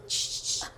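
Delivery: soft unvoiced sniffing sounds; mock sniffles. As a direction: imitate tiny sobbing sniffs. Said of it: "Una lagrimita así".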